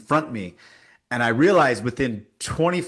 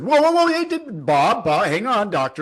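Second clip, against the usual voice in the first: high, loud alarmed whoa whoa; retold doctor cutting in, much higher and louder; alarmed interruption; 9 semitones higher, 4 dB louder than usual. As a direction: jump in to stop a colleague, high and loud. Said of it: "And the other doctor said".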